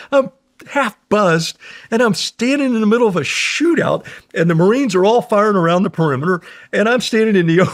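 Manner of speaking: usual speaking voice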